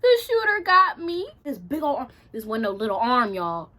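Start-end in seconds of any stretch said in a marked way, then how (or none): 0.0-1.3 high, loud wailing mock panic; tearful-sounding acted panic, pitched high; freaking out. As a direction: wail as if caught, hammed up. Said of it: "I remember freaking out".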